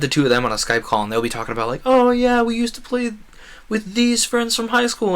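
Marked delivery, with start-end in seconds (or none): 1.9-5.1 mocking high-pitched voice, much higher and louder; teasing impression of friends reminiscing; mimicking friends. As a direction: parrot the friends' chatter in a squeaky mock voice. Friends chatting on Skype, mimicked.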